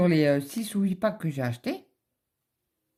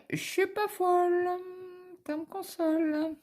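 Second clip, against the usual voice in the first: much higher, squeaky triumphant voice; mock triumph; 13 semitones higher than usual. Telling her husband she was right all along, in a squeaky high voice. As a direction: crow it in a silly high voice.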